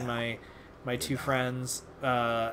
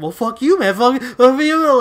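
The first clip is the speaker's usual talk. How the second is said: imitated furious yell, much higher and louder; angry strangers; 11 semitones higher, 14 dB louder than usual. Strangers in the lobby yelling back, mock furious shout. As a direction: fire it back like offended strangers. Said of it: "you heard".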